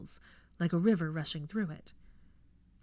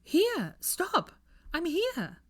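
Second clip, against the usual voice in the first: calling out; 12 semitones higher, much slower than usual. A narrator's reading. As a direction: cry out high so he can find you.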